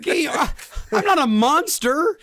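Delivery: loud, high, slow protest through laughter; laughing outcry. Real, not acted. Refusing to nuke the zoo, shouting through laughter. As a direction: cry out laughing that you'd never do that.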